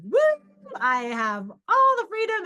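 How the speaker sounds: mock-giddy cry of freedom; excited whoop. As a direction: whoop it up, stretching the words. Retelling her newfound freedom.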